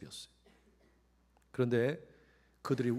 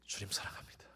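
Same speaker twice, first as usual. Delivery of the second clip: whispered confession; 7 semitones lower, 8 dB quieter than usual. Acted. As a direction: whisper it with a bowed head. (베드로가 속삭이며 고백하는 목소리)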